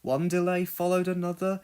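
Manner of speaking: usual speaking voice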